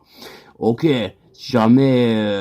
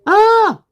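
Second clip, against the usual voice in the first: very high loud held note; piercingly high sustained ah; vocal warm-up; 6 dB louder than usual. Shown as a demonstration. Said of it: "je me chauffe la voix".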